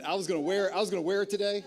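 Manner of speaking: barely holding back a laugh; amused, building giggle; laughing excitement. Real, not acted. Joking he'd wear the shirt.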